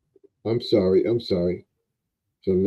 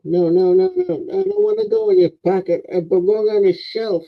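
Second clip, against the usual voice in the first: much higher, fast little elf protesting; elf toy voice; 12 semitones higher, 3 dB louder than usual. Acted. Man voicing his elf toy that refuses the pocket, high elf protest. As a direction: whine like a tiny elf who won't go in the pocket.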